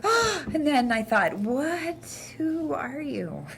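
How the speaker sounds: gasping, then slow and puzzled; mock shock at the screen; surprised gasp. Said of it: "I was like".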